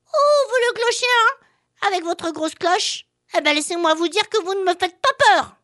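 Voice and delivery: high, loud cheeky little voice; a brave tiny flea, defiant; baby flea voice. Acted. Flea defies the steeple.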